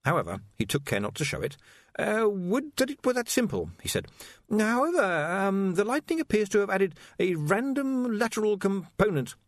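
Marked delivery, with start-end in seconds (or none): 2.0-9.4 high, stammering, anxious voice; nervous young wizard. Acted. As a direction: stumble over the words nervously.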